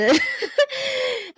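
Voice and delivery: bright high-pitched laugh. Real, not acted. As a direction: burst into a high giggle. Laughs at own repetition.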